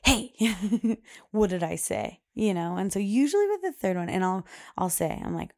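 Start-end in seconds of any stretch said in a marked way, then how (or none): 0.0-2.2 retold parent's warning, a bit louder; big voice. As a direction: imitate a mom's voice getting big. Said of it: "my voice gets, like, big".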